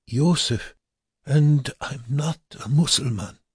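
high, weak protesting voice; frail prisoner. A narrator's reading. Dying man giving his name, high frail protest.

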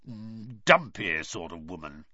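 low, grudging growl. A narrator's reading. Uncle recalling Ron's mother, growling disdainfully. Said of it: "he growled finally".

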